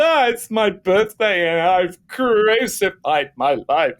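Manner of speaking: high, slow, wailing self-pity; mock drunk lament. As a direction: drag it out like a sorry drunk. Drunk birthday call to friend.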